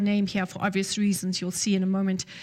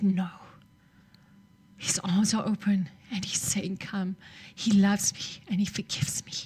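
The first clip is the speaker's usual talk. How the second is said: awed whisper; 3 semitones lower than usual. Retold or imitated. Young woman tells the preacher her vision, in hushed whisper. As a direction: whisper it like a sacred secret.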